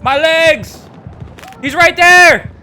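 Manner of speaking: frantic high panicked shouts; shaky stammering panic. Real, not acted. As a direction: yell out in a panic. Panic under fire in game.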